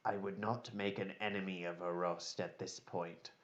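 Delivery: low, hushed, voiced aside; whispered warning. Afkiel privately warns Brock about the elf, hushed. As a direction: drop to a discreet undertone. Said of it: "turns and whispers to you".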